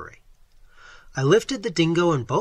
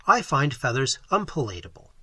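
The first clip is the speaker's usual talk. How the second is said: usual speaking voice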